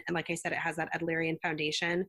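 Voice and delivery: usual speaking voice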